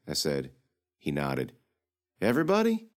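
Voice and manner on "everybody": high disbelieving question; can't believe it, ask high; shocked question. Acted. Girl asking if the whole city died, stunned.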